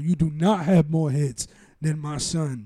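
slow, loud, spaced-out words; emphatic. Real, not acted. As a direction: punch every word slowly. Defending his favorite rapper.

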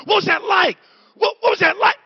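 high eager shout; eager questioning. Retold or imitated. People pestering the pastor about fame, high eager shout. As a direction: fire the question like a wide-eyed fan. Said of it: "people ask me all the time".

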